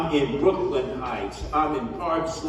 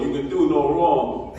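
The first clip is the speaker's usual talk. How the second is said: ordinary delivery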